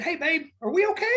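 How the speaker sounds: high, sweet mock-innocent ask; husband imitating the wife's morning-after apology, high and sweet; sheepish wife. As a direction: sweeten it up like nothing happened. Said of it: "And you said".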